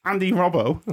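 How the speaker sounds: laughing through a high Scouse name; Scouse accent. Pretend. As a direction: Liverpool accent, cracking up. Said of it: "Yeah, that's my Scouse accent".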